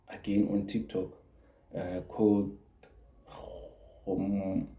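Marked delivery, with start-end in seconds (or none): none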